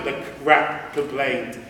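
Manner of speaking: usual speaking voice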